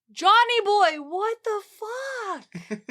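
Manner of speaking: high, drawn-out laughing disbelief; reader's disbelief. Retold or imitated. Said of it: "you as the reader are like".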